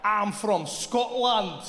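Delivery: put-on very slow, careful Scottish accent; bluffing in a Scottish accent. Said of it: "speak to people in their accent".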